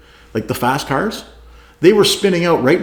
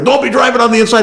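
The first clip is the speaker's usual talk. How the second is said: radio order; 10 semitones higher, 4 dB louder, faster than usual. Retold or imitated. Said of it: "he gets on the radio".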